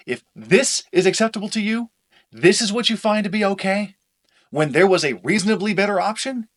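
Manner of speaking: angry. Acted. Heated argument.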